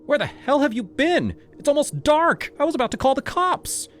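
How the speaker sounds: high, loud frantic scolding; furious mother demanding answers; angry mom yelling. Acted. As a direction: shout at him like a worried mother. Mother yelling at late son.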